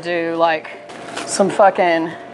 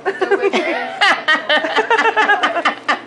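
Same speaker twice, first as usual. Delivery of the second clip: laugh; 9 semitones higher than usual. Real, not acted. Laughing at own joke.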